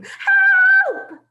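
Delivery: very high, loud cry for help; a boy screaming for help at the door; terrified scream. Acted. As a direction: scream for your life. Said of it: "I began to scream".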